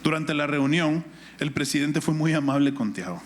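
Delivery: ordinary talk, nothing marked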